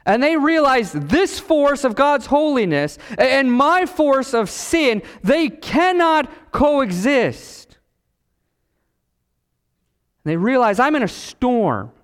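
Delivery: high, emphatic sermon climax; forceful preaching. Real, not acted. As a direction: drive each phrase home, stretching the last words.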